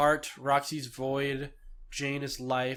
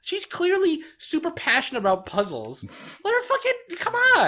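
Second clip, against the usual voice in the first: mock exasperation; 13 semitones higher than usual. Fan defending Jane's love of puzzles, mock exasperation. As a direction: argue her case, ending in an exasperated outburst.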